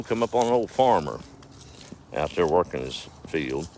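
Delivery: ordinary talk, nothing marked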